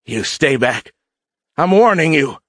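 high, rising shout; shouted warning. A narrator's reading. Cadet with a rifle warns the warrior back, shouting. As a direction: build from firm to a high, loud warning. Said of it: "he shouted at Jates".

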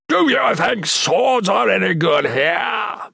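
slow, high-pitched monster roar; thundering. A narrator's reading. Monster scorning Shatan's sword, thundering. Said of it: "Mickig thundered".

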